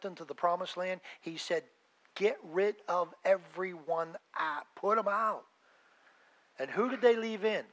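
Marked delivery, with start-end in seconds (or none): 2.1-5.5 commanding God. Pretend. God's command to Israelites.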